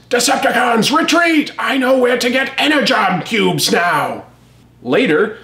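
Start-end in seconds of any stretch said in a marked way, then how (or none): 0.1-4.2 high, hammed-up villain shout; Megatron's shout. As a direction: bellow the retreat order like a cartoon tyrant. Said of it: "Megatron shouts".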